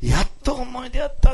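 high, stretched cry of finding at last; heartfelt realisation. Retold or imitated. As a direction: act out her relief at finding it. Acts out her moment of realisation. (感極まった彼女を高い声で再現)

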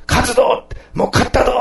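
high, triumphant battle cheer; the king rallying his people, voiced as a triumphant cheer; rallying cry. King urging troops on, in a high triumphant cry.

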